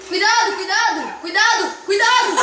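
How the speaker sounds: rising warning. Real, not acted. Warning friend during game.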